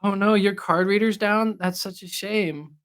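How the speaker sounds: high, hammed-up pushover voice; mock fawning sympathy. Teasing co-host as a buyer pitying the seller, mock fawning.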